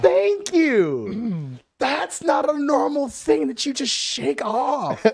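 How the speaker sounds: high, slow, emphatic comic outburst; vindicated exclamation. Retold or imitated. Friend finally agreed with about the mouse, vindicated. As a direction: cry it out, finally proven right.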